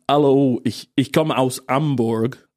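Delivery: Yorkshire-style German, "Hallo" as "allo", "Hamburg" as "Amburg"; dropped H. Retold or imitated. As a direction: leave the H silent in "Hallo" and "Hamburg". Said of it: "weil man H nicht ausspricht".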